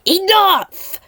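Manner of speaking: a high, loud explosive yell; a very loud, enraged cry; furious shout. Acted. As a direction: blow up with rage. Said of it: "made Lao made his fists clench".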